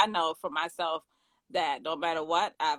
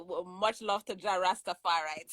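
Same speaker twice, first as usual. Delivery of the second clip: light Jamaican patois lilt; Jamaican accent. Pretend. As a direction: greet them like a Jamaican Rasta. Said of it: "putting on my Jamaican accent".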